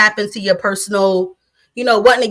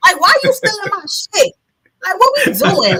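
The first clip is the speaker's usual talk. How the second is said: higher, louder mock outrage with a laugh in it; indignant quote; 9 semitones higher, 4 dB louder than usual. Retelling the reaction to King Troll stealing clips, higher mock outrage. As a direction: act out being annoyed at the troll. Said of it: "I'm like".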